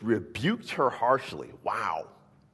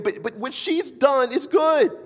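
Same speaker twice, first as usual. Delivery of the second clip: much higher, loud proclamation; fervent shout; 6 dB louder than usual. Retold or imitated. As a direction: shout it out in her defense. Jesus' answer paraphrased fervently.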